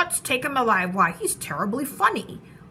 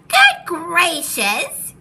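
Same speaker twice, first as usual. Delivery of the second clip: loud, high, stretched exclamation; gasp, then cry it out in shock; astonished outburst after a sharp intake of breath; startled gasp; 7 semitones higher, 9 dB louder, much slower than usual. A narrator's reading. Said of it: "gasped Maisie".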